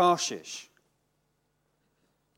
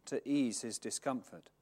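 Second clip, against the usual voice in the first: measured, lowered reading voice; scripture reading; 4 semitones lower than usual. Acted. Preacher reading scripture to the congregation, measured. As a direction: read the passage solemnly. Reads the Jonah passage aloud.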